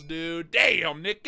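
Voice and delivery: ordinary talk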